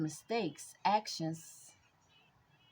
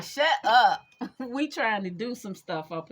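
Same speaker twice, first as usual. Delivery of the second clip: mock shut up; 4 semitones higher, 9 dB louder than usual. Voicing others telling her off.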